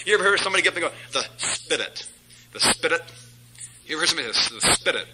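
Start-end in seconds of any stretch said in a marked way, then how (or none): 1.1-3.0 "Spirit" with a tapped r, like "spit-it"; mocked affected pronunciation. Preacher mimicking somebody who gets up, affected "Spirit". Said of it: "Something's wrong with that guy". 4.6-5.1 "Spirit" dropped low as "SPIDDIT"; mocked affected pronunciation. Preacher quoting that guy with something wrong, affected "Spirit". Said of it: "not spit it".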